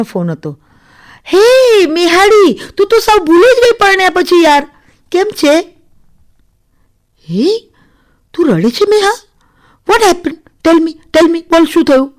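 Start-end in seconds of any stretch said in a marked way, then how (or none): 1.3-5.7 high, loud, teasing phone hello; excited friend's greeting. Acted. Friend phones newly married girl who forgot her, excited teasing. 7.3-12.1 high, urgent questioning; alarmed concern. Acted. Friend on phone realises she is crying, alarmed.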